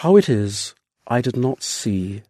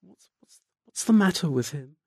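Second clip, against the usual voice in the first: breathless, near-whispered stammer of fear; a half-voiced, trembling question; vacant trepidation; 8 dB quieter than usual. A narrator's reading. Scared wife asking the doctor about her husband, in a near-whisper. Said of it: "in a sort of vacant trepidation".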